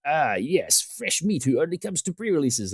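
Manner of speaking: a light hint of a predator spotting easy prey; gloating opponent. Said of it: "You can see their eyes light up".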